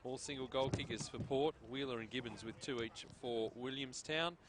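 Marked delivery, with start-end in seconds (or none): none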